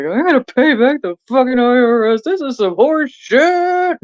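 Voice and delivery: high, slowed complaint about the IRS; mock tearful outrage; fed-up rant. Quoting own angry crying moment.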